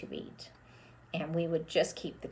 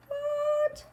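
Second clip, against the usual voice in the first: high comic gasp of disbelief; surprised squeak. Acted. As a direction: yelp it in mock shock. Surprised reaction.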